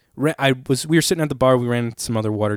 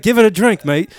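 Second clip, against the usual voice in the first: put-on British accent; 7 semitones higher, 5 dB louder than usual. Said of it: "British accents".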